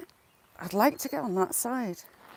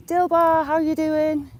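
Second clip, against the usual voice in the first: high, raised-voice hello; excited greeting; 8 semitones higher, 5 dB louder, slower than usual. Real, not acted. Vlogger greets a viewer joining mid-sentence, excited greeting. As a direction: hail a friend who has just turned up.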